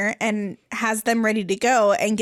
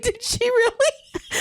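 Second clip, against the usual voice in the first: high, drawn-out mock astonishment; incredulous, pitched way up and slowed; amused disbelief; 12 semitones higher, slower than usual. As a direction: act stunned while holding back laughter. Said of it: "my eyes get a little bit bigger".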